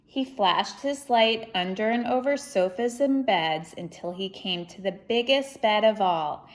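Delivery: lively, expressive storybook reading; reading to children. Acted. Reading Corduroy aloud to children, lively storybook voice. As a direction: read it out to a young audience with energy. Reads picture book aloud.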